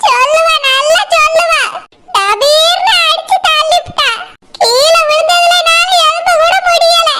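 high, tearful lament; wailing complaint. Acted. Mother-in-law accusing daughter-in-law to son, wailing. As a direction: sob out the accusation, drawing words out.

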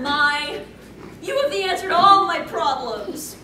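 ordinary talk

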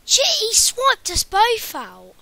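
real gamer rage, high and loud; frustrated outburst. Real, not acted. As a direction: cry out at being killed. Killed in game, frustrated outburst.

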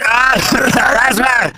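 normal talking voice

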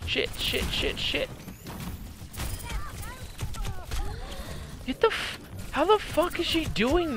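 whispered curses; tense whispered swearing; whispered panic. Real, not acted. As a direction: hiss curses under pressure. Tense moment in game.

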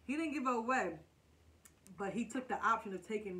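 eating between words; chewing while talking. Real, not acted. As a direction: mouth partly full. Seen 1: bites food just before; talks between bites, jaw working, food in hand.

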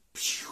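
loud, airy "pew"; whooshing jump sound. A narrator's reading. Storyteller answers what the cow does, whooshed sound effect. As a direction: whoosh it out without voice.

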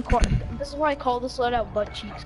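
ordinary delivery

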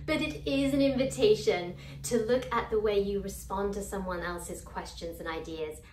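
ordinary talk, nothing marked

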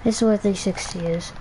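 ordinary talk, nothing marked

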